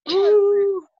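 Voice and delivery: loud high alarmed exclamation; startled ooh. Acted. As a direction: yelp in mock fright. Fox startled by howl.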